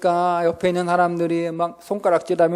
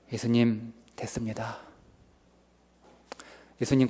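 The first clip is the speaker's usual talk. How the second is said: softer, lower, giving-up prayer; resigned prayer; 6 semitones lower, 5 dB quieter than usual. Retold or imitated. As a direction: let it sag like a man who has given up. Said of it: "이렇게 보통 말하고 싶지 않을까요?". (체념한 기도)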